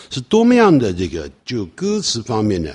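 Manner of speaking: ordinary talk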